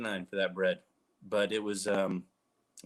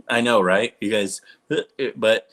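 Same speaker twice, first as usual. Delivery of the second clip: excited; 9 dB louder than usual. Real, not acted. Gushing about earwax videos.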